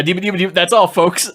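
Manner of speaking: high, stuttering cartoon farewell; cartoon sign-off. Joking that it's the last HD DVD title, cartoon sign-off. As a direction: stutter into a chirpy cartoon goodbye. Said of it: "where all it said is".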